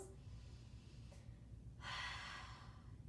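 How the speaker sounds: long drawn-out breathy exhale; extended audible haah; long H sound breath. Shown as a demonstration. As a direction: fog up the mirror, slowly. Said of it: "make that H sound".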